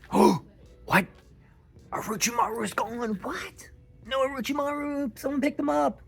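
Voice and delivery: gasp, then squeak out the news; shocked disbelief. Real, not acted. Gasps at missing figure.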